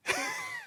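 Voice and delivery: a sudden high-pitched burst of laughter. Real, not acted. Laughing at his own failed accent, high burst of laughter. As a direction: laugh at your own fumble, high and short.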